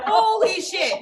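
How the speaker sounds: sudden loud holy shit; mock horror at dressing a limp baby; shocked exclaim. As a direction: blurt it out in shock. Stand-up retelling shock.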